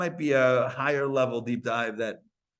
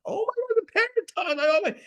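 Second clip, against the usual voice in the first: much higher, squealing mock delight; delighted surprise. Person delighted hearing a parrot talk, high excited squeal. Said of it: "delight when you hear a parrot".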